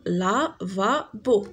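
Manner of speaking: لافابو ببطء مع مد المقطع الأخير; كرر لافابو ببطء للتلاميذ; نطق بطيء مقطع بمقطع. Shown as a demonstration. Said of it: "ان لافابو". (لافابو بنطق بطيء وواضح)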